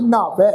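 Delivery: ਨਹਾਵੈ with the half haha under ਨ, said clearly as naha-vai; ਨਹਾਵੈ ਨੂੰ ਅੱਧੇ ਹਾਹੇ ਨਾਲ ਸਪਸ਼ਟ ਉਚਾਰੋ; Gurbani pronunciation demo. Shown as a demonstration. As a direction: carefully voice the h in ਨਹਾਵੈ. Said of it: "ਨੰਨੇ ਅੱਖਰ ਦੇ ਪੈਰ 'ਚ ਅੱਧਾ ਹਾਹਾ ਲਗਾ ਕੇ ਪਾਠ ਉਚਾਰਨ ਕਰਾਂਗੇ".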